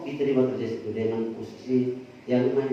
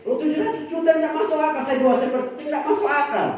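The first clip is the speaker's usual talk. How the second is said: incredulous outburst; 12 semitones higher, 4 dB louder, faster than usual. Real, not acted. Incredulous emphatic exclamation.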